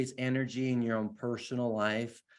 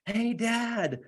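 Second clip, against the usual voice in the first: high-pitched, drawn-out mock kid's yell; imitated excited scream; 10 semitones higher than usual. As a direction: call out like a thrilled three-year-old, without real volume. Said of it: "running around the house screaming".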